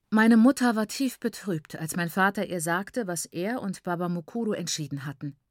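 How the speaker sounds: ordinary delivery, nothing marked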